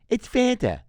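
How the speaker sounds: high mock-mom voice, "Fanta" as FAN-tuh; short-A pronunciation. Mom insisting to son on "Fanta" as FAN-tuh, high mimicry. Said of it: "whether it's pronounced Fanta or Fanta".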